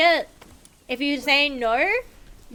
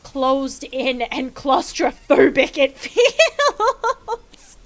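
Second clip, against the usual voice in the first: slow, louder mock praise with a laugh breaking in; sarcastic drawl; 5 dB louder, much slower than usual. Gamer mocking her own cramped base, sarcastic. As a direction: drag it out, laughing at yourself. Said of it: "Look at how".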